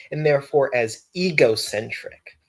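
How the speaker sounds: ordinary talk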